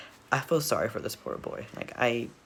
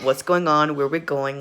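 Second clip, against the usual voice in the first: louder, bewildered mock questioning; shocked; 3 semitones higher, 7 dB louder than usual. Viewer imagining being in the boy's place, shocked. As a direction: act out a mildly shocked reaction. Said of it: "I'd be in shock".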